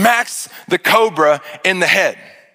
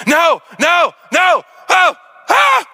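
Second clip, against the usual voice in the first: very high, loud rising scream; waking scream; 11 semitones higher, 3 dB louder, slower than usual. Retold or imitated. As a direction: jolt awake screaming. Waking from nightmare.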